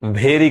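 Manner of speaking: भेरी with an aspirated 'bh'; common mispronunciation. Shown as a demonstration. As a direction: say भेरी as 'BHE-ree'. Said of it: "maximum लोग गलत पढ़ते हैं". (भेरी को 'भ' से शुरू करें)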